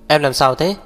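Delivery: hurried, concerned question, understated; urgent cry. A narrator's reading. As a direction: call out with light urgency. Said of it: "vội la lên".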